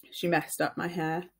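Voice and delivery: plain reading voice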